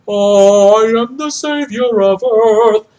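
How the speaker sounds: high, loud, slow proclamation as a mock messiah; grandiose savior. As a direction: declaim it like a pompous god. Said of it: "he was like".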